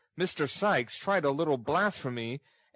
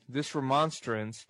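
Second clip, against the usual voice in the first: ordinary delivery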